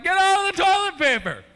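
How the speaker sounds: high, loud shout; yelling at the cat. Retold or imitated. Owner catching cat in toilet paper, high loud shout. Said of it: "I'm like".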